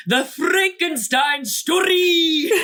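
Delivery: rolled R's. Retold or imitated. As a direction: roll the R's like an old movie actor, laughing. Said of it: "how much these men roll their R's".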